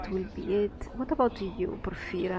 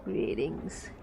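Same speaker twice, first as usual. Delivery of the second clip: much lower, rasping greeting; low raspy voice; 11 semitones lower, 7 dB quieter than usual. Acted. Tattooed gambler greeting the player, low and raspy. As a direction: growl it like a shark-toothed gambler. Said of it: "His voice is low and raspy".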